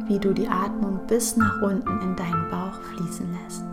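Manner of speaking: ordinary talk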